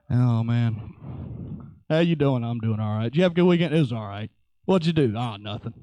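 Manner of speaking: low, deadpan two-way chitchat; bored small talk. Acted. Preacher acting dull coworkers on Monday, bored and listless. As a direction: question lifts, answer sinks low and dull.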